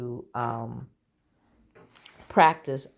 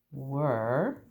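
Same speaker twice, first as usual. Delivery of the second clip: normal talking voice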